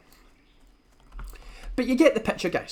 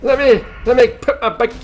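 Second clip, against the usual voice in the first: put-on higher, louder clingy old granny; 5 semitones higher, 7 dB louder than usual. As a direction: chase them down like a kissy granny. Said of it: "let Granny give me a kiss".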